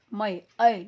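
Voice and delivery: मै, ऐ said loud and clear as ai; ai vowel shown. Shown as a demonstration. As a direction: stress the ai sound in मै and ऐ. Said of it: "ऐ की मात्रा बोलते हैं इसको". (ऐ की आवाज़ ज़ोर से साफ़)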